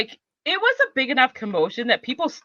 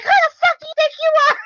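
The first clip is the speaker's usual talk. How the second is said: fast shrill yell, much higher; high shriek; faster than usual. Retold or imitated. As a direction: scream it at him, fast. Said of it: "she's just like".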